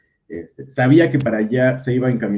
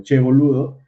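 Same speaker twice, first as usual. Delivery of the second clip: mock Argentine catchphrase; 3 semitones higher, 6 dB louder than usual. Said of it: "los mexicanos ... pensamos lo de".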